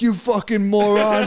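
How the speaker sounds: high, stretched-out comic indignation; retold angry insult. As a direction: re-enact cursing out a rival fan, slowly. Retelling his insult at a rival fan.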